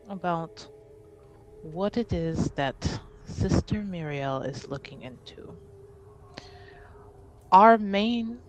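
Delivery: slow, deliberate formal speech with weighty pauses; composed, affronted noble. Acted. Ade names the house's primary concern, slow and stately. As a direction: state a grievance with measured authority.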